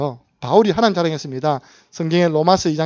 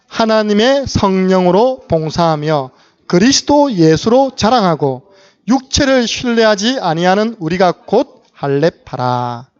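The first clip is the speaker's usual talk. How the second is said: slow, loud, declaimed Bible verse; measured congregational recitation, phrase by phrase; scripture reading; 2 semitones higher, 5 dB louder, slower than usual. Real, not acted. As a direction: read it out slowly for a congregation to follow. Reads the Bible verse aloud.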